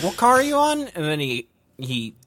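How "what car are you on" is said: pitched way up, asking; high voice. Retold or imitated. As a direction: squeak the question out high. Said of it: "I was like".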